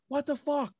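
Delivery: high-pitched imitation of a startled yell; jumped-up squeal of a mock yell; mock scream. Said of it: "when Amber fucking starts screaming".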